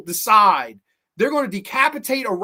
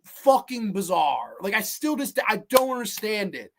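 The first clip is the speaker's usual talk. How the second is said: normal talking voice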